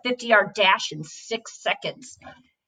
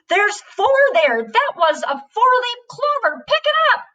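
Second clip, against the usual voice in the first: high rising outraged protest, louder; a boy furious his clover was thrown away; outraged child; 11 semitones higher, 3 dB louder than usual. Acted. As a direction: climb higher with each line in indignation. Boys quarrel over clover.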